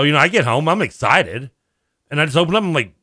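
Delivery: ordinary delivery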